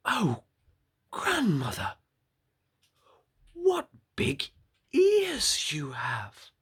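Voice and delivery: put-on high girl voice sinking to a slow hush on the last words; wondering little girl. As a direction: ask in awe, high then hushed. Girl questions the wolf.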